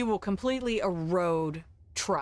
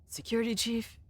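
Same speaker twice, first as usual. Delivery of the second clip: normal talking voice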